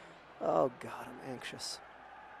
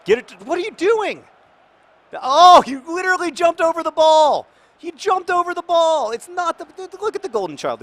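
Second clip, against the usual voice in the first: exasperated yelling; 16 dB louder than usual. Real, not acted. Player jumps over the ball.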